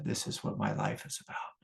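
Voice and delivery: hushed, breathless whisper of realisation; awed whisper. Retold or imitated. Teacher recalling his first retreat, hushed whisper. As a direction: whisper it like a revelation dawning.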